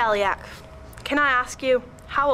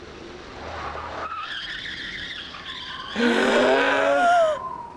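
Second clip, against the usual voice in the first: dramatic gasp. Acted.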